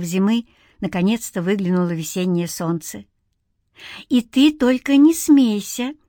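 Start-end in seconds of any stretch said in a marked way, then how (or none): 4.1-5.9 slow, higher, tentative musing; pensive, drawn-out request; thoughtful. A narrator's reading. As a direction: ease into it thoughtfully. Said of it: "задумчиво продолжила Марьяна".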